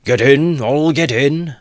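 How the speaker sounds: loud, high shout; cried out. Acted. Mikulka calling everyone aboard, loud cry. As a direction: bellow the invitation. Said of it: "cried Mikulka".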